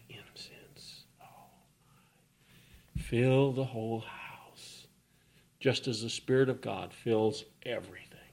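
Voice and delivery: hushed, reverent whisper; a soft awed whisper; whispered awe. Acted. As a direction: breathe it like smelling incense. Awe at incense smell.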